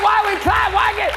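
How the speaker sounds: high-pitched protesting voice; mock audience complaint. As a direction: whine on behalf of the crowd. Voicing a puzzled audience member.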